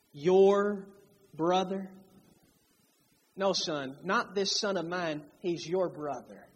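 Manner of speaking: loving father. Retold or imitated. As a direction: tenderly remind him who his brother is. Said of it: "in a very loving manner".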